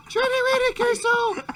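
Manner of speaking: very high, loud falsetto; hammed-up squeaky pet call; high-pitched call. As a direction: sing out in a piercing falsetto to lure a dog. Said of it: "you gotta do it in a high-pitched voice".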